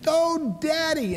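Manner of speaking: high, loud squeal of joy; excited cry. Retold or imitated. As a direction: burst out in delighted squeal. Daughter leaps into father's arms.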